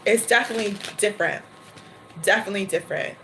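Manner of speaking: ordinary talk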